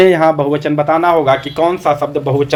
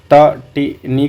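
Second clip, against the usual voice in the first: तटिनी broken slowly into ta, ti, ni; slow teacher's breakdown of तटिनी; syllable by syllable; much slower than usual. Shown as a demonstration. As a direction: sound out तटिनी with a pause after each syllable. Teacher sounds out a new term.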